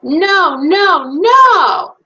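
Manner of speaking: put-on high, loud childish no, no, NO building up; child protesting. Child refusing bedtime in story.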